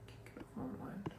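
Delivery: whispered. Real, not acted. Sings along softly.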